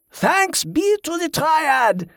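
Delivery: high, slow ringing proclamation; proclaiming. A narrator's reading. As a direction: call it out to the crowd. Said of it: "he proclaimed".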